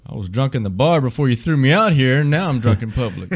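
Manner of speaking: loud, high-pitched protest in a retold joke; comedy bit quote. Comedian quoting a drunk thrown out of a bar, mock-indignant. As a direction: belt it out as a mock drunken complaint.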